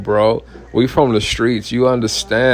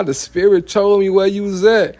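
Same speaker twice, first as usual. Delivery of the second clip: mock prophet; 8 semitones higher than usual. Guy quoted at the van.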